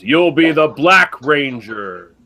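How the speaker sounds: slow, booming parody of a commanding mentor; Zordon. Retold or imitated. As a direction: proclaim it with drawn-out, hammed-up grandeur. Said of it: "Zordon brings all the kids together".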